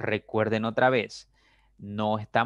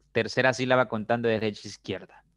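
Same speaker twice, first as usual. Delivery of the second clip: ordinary talk, nothing marked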